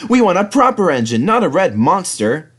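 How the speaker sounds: high, loud, insulting truck voices; rude trucks. Acted. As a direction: jeer at the red engine.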